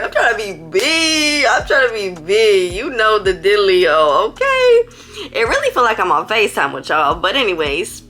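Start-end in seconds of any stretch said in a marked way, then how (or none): 0.0-5.3 hammed-up hunger. Real, not acted. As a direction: whine it playfully to your viewers, really starving. Hamming up hunger to viewers.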